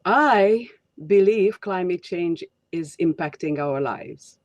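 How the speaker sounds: "I" drawn out and emphasized at the start; stress on I. Shown as a demonstration. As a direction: put the weight on "I", then let the rest fall away. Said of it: "here is the first way".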